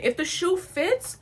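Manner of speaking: high, slow, challenging repeat; indignant echo. Retold or imitated. Throws saying back as indignant threat.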